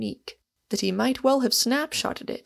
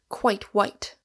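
usual speaking voice

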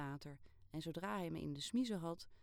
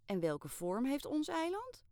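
eager question; 9 semitones higher, 5 dB louder than usual. Acted. Girl quizzing bored friend.

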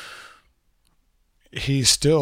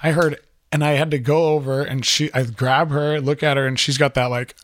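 normal talking voice

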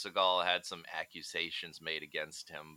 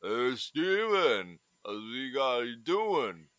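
Stallone impression; 3 semitones higher, slower than usual. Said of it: "he's doing his".

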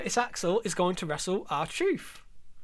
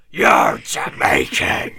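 slow, much lower, louder whispered shout; hammed-up mock proclamation; 12 dB louder, much slower than usual. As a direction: bellow it in parody. Mocking Vince's thinking.

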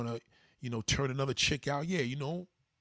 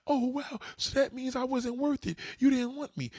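hurt partner voice; 11 semitones higher than usual. Retold or imitated. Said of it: "'cause we're like".

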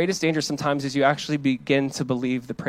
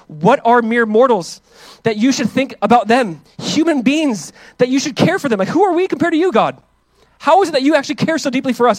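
higher, louder awe-struck cry; fervent preaching; 8 semitones higher, 5 dB louder than usual. Acted. Pastor crying out the psalm to God in awe, higher and louder. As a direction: lift the psalm up in wonder.

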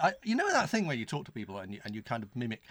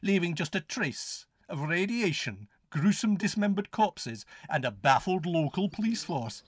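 hammed-up horror trailer voice, higher; melodramatic blurb reading; 5 semitones higher, 8 dB louder than usual. Reading the back of the notes, hammed-up horror. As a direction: relish every lurid word, drawn out.

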